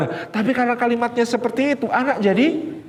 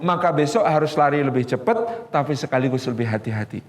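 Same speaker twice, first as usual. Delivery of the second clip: ordinary talk, nothing marked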